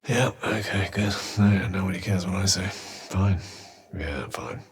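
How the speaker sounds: low, resigned grumbling; muted, put-upon surrender; sulky. Acted. Sulks that nobody cares.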